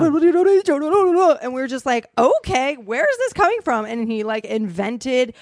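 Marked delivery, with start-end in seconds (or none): 0.0-3.8 baffled. Retold or imitated. Baffled retelling of first meeting.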